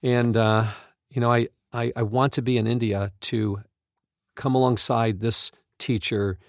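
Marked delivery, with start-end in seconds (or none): none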